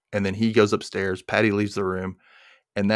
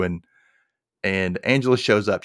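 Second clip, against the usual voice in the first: ordinary talk, nothing marked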